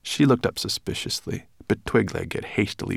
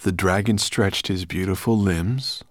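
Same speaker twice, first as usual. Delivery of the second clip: plain reading voice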